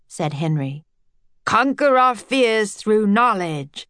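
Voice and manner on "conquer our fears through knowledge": slow, eager echo of "Conquer our fears through knowledge"; enthusiastic repeat. A narrator's reading. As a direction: savour each word like a new motto. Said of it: "repeated his thought with enthusiasm".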